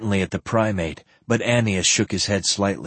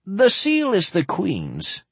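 put-on old man voice; 11 semitones higher, slower than usual. Old earl speaks in story.

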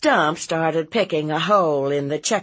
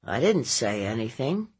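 evasive nephew. Acted. Nephew denying to his aunt, subdued and evasive. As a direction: deny it in a subdued boyish mumble.

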